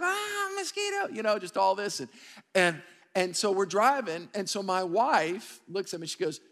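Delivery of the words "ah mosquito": high-pitched imitation of panicking kids; hammed-up childish alarm; mock panic. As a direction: squeal it like a kid swarmed by bugs. Acting out kids panicking at mosquitoes.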